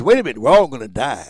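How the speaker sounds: fast, loud announcement; mock alarm, loud and quick; loud punchline. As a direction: hit them with it. Punchline to AARP audience.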